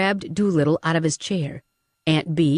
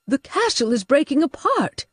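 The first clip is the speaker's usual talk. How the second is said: put-on higher, urgent older woman's voice; alarmed Aunt Bee; 8 semitones higher than usual. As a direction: warn everyone the walls are going. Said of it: "Aunt Bee said".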